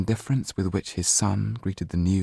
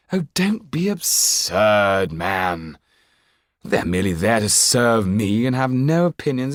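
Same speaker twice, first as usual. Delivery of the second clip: scornful Marquis; 4 semitones higher, 4 dB louder than usual. Acted. Marquis scoffing at the lawyer's caution, haughty and loud. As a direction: dismiss the servants as furniture.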